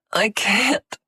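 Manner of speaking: high, loud panting protest; panted. Acted. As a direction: pant it out. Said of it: "she panted".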